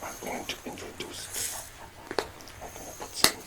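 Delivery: hushed, breathy secret-sharing whisper; conspiratorial whisper. Acted. Space lord confiding his rare coffee trick to viewers, whispered.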